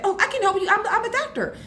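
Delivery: high-pitched tot playing doctor; imitating small child. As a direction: play a child eagerly coming to the rescue. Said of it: "She'll say".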